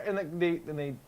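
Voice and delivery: ordinary talk, nothing marked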